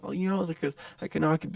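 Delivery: high, stammering imitation; hesitant mock voice; timid stranger. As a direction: fumble for words as the awkward guy. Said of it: "and, like, timid".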